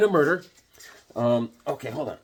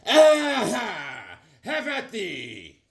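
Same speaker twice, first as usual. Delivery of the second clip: hammed-up swashbuckler, slowing down; mock old-style challenge; 6 semitones higher, much slower than usual. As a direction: challenge like a musketeer. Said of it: "They're like".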